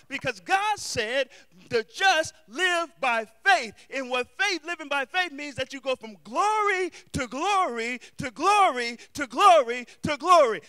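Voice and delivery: loud climbing sermon, words stretched and hammered; preaching at full force. Acted. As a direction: whoop it up toward the climax.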